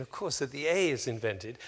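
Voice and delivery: ordinary talk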